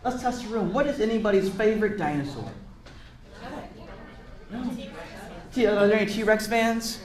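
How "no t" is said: ordinary delivery, nothing marked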